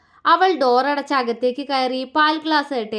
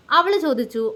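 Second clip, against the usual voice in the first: plain reading voice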